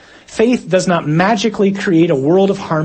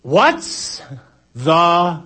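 drawn-out, word-by-word mock yell; yelling back; much slower than usual. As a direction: call it back over a storm, word by word. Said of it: "yelling back, something like this".

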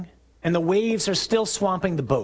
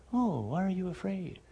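soft, mild voice, much too quiet for a storm; mock gentle question; 4 semitones higher, 7 dB quieter than usual. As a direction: ask it softly, as if all were calm. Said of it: "He doesn't say".

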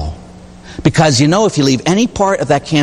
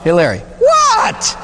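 Hey, Larry, what? pitched way up and drawn out slowly; high mock call; slower than usual. To the priest, calling his name for no reason, high and slow. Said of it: "It's like looking at me and saying".

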